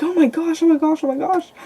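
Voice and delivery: oh-my-gosh panic, retold; freaking out. Boyfriend reacting to the fallen charm, imitated freaking out. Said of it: "freaking out".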